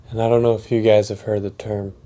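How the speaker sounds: ordinary talk, nothing marked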